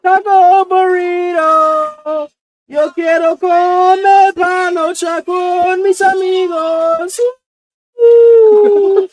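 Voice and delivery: high, slow, loud sing-song mock Spanish; mock Spanish accent. Friend hamming up a Univision star, slow and sung. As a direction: croon it like a cheesy Spanish TV host.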